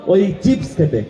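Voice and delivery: high excited play-by-play; excited commentary. Real, not acted. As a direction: lift up for the near miss. Commentator excited over missed shot.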